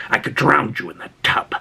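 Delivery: lightly voiced unhinged villain; crazed threat. Reviewer replaying the line where he snaps, mildly menacing imitation. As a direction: imitate a deadly serious threat, understated. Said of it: "he snaps and he's really crazy".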